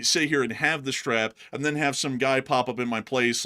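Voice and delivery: ordinary talk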